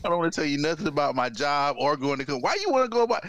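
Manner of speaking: normal talking voice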